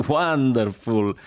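slow, loud, laughing through the word. Acted. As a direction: let the laugh spill over wonderful. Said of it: "Jack rideva così di cuore".

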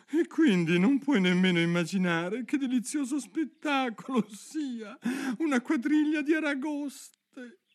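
high-pitched, quavering mock-sobbing delivery; tearful turtle. A narrator's reading. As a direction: marvel tearfully in a high creature voice. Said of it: "con le guance rigate di pianto". (voce acuta e piangente)

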